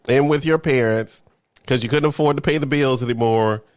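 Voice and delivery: ordinary talk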